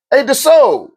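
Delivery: high, drawn-out name call. Acted. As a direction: hail someone eagerly from a distance. Calling a name out, first way.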